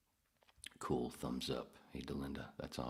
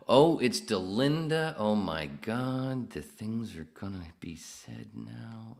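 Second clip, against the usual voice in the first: high, drawn-out gushing greeting; mock excitement; 6 semitones higher, 9 dB louder, slower than usual. Host greeting Delinda joining chat, high drawn-out mock excitement. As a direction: stretch it out high and loud.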